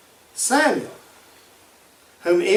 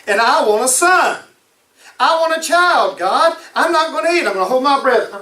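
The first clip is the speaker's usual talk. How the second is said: higher, whiny mock tantrum of a childless wife; pouting Hannah; 6 semitones higher than usual. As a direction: act out a sulking woman demanding a child. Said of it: "In other words".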